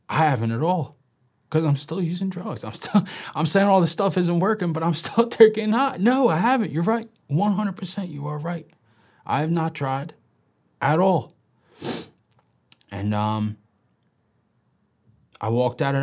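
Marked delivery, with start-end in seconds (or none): 0.1-6.0 laughing admission. Retold or imitated. Laughing realization of the truth. 6.0-11.3 high, loud, laughing "No, I haven't"; he finally admits it to the counselor, loud and high; excited admission. Retold or imitated. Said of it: "it clicked".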